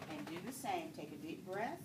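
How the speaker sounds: low, soft, unhurried breathing cues; steady bedside voice for deep breaths; calm breathing coaching. Acted. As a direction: guide each breath in and out gently. Nurse coaches patient's deep breathing.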